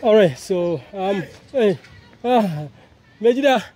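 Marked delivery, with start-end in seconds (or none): none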